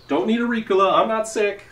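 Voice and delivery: high, loud, overexcited actor-buddy greeting; pumped-up celebrity bro. Pretend. Dad at the park posing as a movie star, loud and high. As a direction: greet him like a starstruck fellow celebrity, way too pumped. Persona jokes about cough drop.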